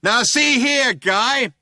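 high, blaring cop on a loud hailer. A narrator's reading. Cop to the people he is shooting at, shouting through loud hailer. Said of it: "said the voice on the loud hailer".

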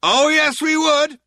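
high, loud retort; shouting cop. A narrator's reading. Cop insisting he would carry out the threat, shouting. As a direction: holler it back at them. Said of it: "shouted the cop".

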